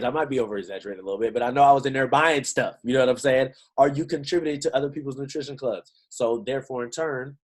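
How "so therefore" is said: ordinary talk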